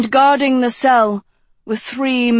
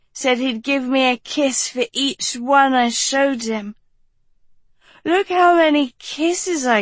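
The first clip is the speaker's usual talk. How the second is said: put-on drunken girl; 4 semitones higher than usual. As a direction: play it woozy and childlike. Drunk Dot voiced.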